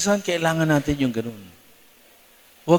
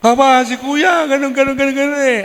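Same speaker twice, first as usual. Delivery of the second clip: put-on tattling child voice; 10 semitones higher, 3 dB louder than usual. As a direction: complain like a little sister snitching. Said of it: "mahilig magsumbong".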